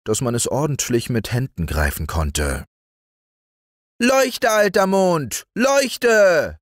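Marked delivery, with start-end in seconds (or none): 4.0-6.6 put-on high, loud child's scream; boy screaming. Häwelmann screaming for the vanished moon, high loud scream. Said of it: "schrie Häwelmann".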